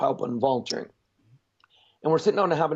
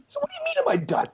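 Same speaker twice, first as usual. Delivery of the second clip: mock indignant disbelief; faster than usual. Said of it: "I'm like".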